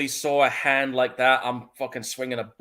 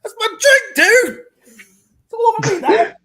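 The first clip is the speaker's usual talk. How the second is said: much higher and louder, yelling in shock; real outraged shout; 10 dB louder than usual. Real, not acted. Host yelling at his co-host over the spilled drink, loud and shocked. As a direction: blurt it out at full volume, stunned.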